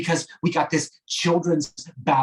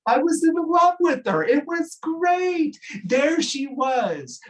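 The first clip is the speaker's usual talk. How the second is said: dreamy gush; 12 semitones higher, 3 dB louder, slower than usual. Real, not acted. Gushing memory of Jen.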